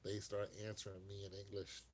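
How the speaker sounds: usual speaking voice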